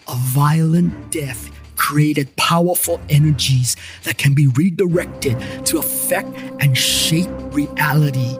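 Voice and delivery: ordinary delivery, nothing marked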